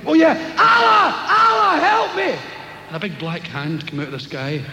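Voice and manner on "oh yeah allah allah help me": hammed-up falling man crying out to Allah; desperate shout to Allah. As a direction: yell to the sky in panic.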